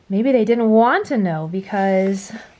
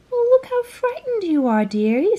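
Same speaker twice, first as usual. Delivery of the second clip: much higher, sweet motherly bug voice; kind ladybug; 11 semitones higher than usual. A narrator's reading. Kind ladybug comforting frightened James, high and sweet. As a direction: coo it like a kind little granny bug. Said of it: "said the kind ladybug".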